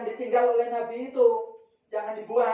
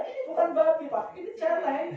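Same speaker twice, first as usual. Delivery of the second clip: high-pitched retelling; 8 semitones higher than usual. Retold or imitated. Repeats villager's argument in high voice.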